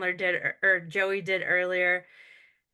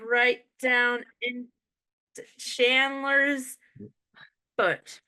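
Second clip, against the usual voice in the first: normal talking voice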